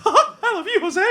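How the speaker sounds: very high, loud cartoon falsetto; Mickey Mouse. Mascot answers the fan who tackled him, squeaky falsetto. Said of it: "Now, I'm doing both voices".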